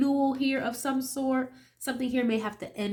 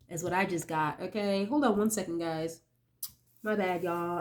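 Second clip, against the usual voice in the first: blocked-nose cold voice; nasal voice; 4 semitones lower, 4 dB quieter than usual. Real, not acted. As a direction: talk through a stuffy nose. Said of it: "the sniffles and the nasally sounding voice".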